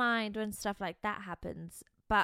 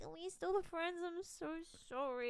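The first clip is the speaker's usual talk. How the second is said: small, high, soft voice; hammed-up sheepish plea; soft little making-up voice; mock meek apology; 8 semitones higher, 8 dB quieter than usual. Retelling meek apology after the fight.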